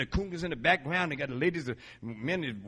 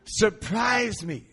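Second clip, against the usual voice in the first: slow, higher and louder; the prayer again, stretched out and bold; emphatic prayer; 6 semitones higher, 4 dB louder, much slower than usual. Retold or imitated. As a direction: lean into each word like a wish. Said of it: "that's a good prayer".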